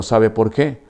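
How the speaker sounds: ordinary delivery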